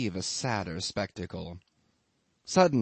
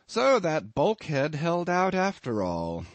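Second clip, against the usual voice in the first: lifted, breezy cheer; cheerful; 6 semitones higher than usual. A narrator's reading. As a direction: toss it out cheerfully, pitched up. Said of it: "I remarked cheerfully".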